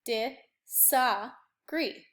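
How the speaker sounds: disagree stretched very slowly, each syllable held apart. Shown as a demonstration. Teacher sounding out disagree for the class, very slow. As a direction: drag disagree out into three long beats. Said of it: "the second beat".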